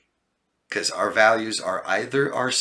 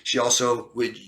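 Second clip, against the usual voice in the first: usual speaking voice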